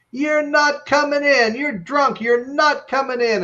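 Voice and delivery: high, slow mock yelling wife. A wife yells at her drunk husband at the gate, mock shouting. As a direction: holler it through the gate, hammed-up. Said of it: "his wife's yelling back".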